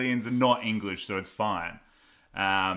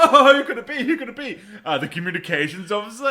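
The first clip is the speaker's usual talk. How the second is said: hammed-up sing-song guessing, much higher and louder; mock Travolta, higher and louder; 8 semitones higher, 7 dB louder than usual. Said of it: "John Travolta's like".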